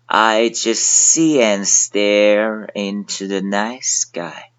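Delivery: 慢速带读; slow read-along. Shown as a demonstration. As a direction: read it slowly with the class. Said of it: "现在跟我一起来读".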